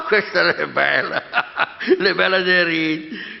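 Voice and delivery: laughing in disbelief. Acted. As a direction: chuckle through the words. Priest laughs at the idea.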